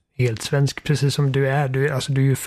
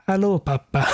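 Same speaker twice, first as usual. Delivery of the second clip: higher, laughing mock Finland-Swedish; imitated Finland-Swedish; 4 semitones higher than usual. Co-host imitating friend answering dad's call, mock Finland-Swedish accent. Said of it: "sen så ringde det och du bara".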